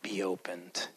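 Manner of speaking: low, soft, hushed command; gentle hush over an ancient word; whispered blessing. Acted. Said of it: "he's gonna whisper in some ancient language".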